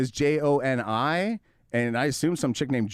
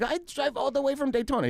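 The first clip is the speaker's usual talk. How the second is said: much higher, squeaky put-on voice, broken English; mocking the buyer; 13 semitones higher than usual. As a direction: play the clueless buyer in a high silly voice. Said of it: "And he's like".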